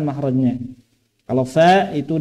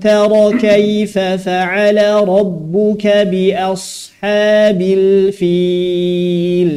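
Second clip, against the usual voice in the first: slow melodic chanted recitation; Quran recitation; 4 semitones higher, 5 dB louder, slower than usual. Shown as a demonstration. Teacher opening the lesson with tajwid recitation. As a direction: recite the verse in a measured chant.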